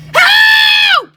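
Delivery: very high, loud, held yell; shout for help. Acted. Trapped in the fridge calling for help, loud shout. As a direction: yell out for rescue.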